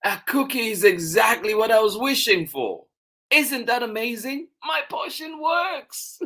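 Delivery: high, loud, excited little Mouse; thrilled child. Acted. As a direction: bubble over with amazement, pitched very high. Mouse believes the potion worked.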